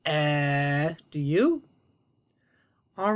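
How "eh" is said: "Eh" buzzing; voiced eh. Shown as a demonstration. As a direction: model "Eh" with throat vibration. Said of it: "I really feel that".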